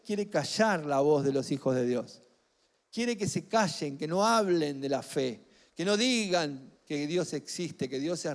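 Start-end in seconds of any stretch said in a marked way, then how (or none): none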